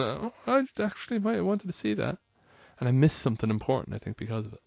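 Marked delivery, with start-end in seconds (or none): 0.0-2.2 high, softer mock dawning surprise; a small half-hearted double-take; mild realisation. Recalling her feed hiding a post, a high mild realisation. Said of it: "And I remember totally going".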